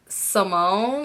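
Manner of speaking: "Simone" with a questioning rise; hesitant, rising "Simone"; unsure of own name. Retold or imitated. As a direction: say "Simone" tentatively, lifting the end like a question. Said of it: "I said it like I was unsure".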